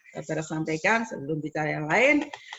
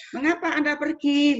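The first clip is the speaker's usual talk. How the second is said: "Mengapa Anda pergi" asked with raised, lifting pitch; rising question intonation; 8 semitones higher, 5 dB louder than usual. Shown as a demonstration. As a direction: lift "pergi" high as a question, louder. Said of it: "Berarti intonasinya naik".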